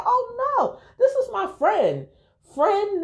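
insisting he is only a "friend"; stress on friend. Retold or imitated. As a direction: hit "friend" to make it clear. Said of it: "I made sure I emphasized on friend".

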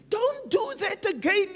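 high, urgent self-scolding; self-reprimand. Acted. Preacher acting out a believer correcting himself after prayer, self-reprimand. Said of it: "you tell yourself".

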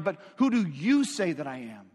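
weight the word you so it points at them; stress on YOU. Shown as a demonstration. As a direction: make "you" the heart of the question. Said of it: "you's" emphatic".

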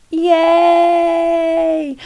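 high loud cheer; excited cheer. Real, not acted. Celebrating the new name.

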